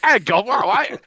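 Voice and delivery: loud, high mock Cockney; Cockney accent. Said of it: "speak with a Cockney accent".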